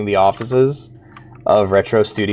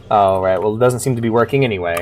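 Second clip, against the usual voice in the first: ordinary talk, nothing marked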